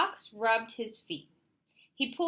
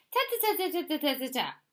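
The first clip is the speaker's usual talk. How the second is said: dismissive interruption; 8 semitones higher, 3 dB louder, much faster than usual. A narrator's reading. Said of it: "interrupted Fox".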